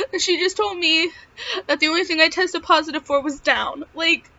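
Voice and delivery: high, tearful voice of relief; welling up. Real, not acted. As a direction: tell it through happy tears. Welling up retelling the good news.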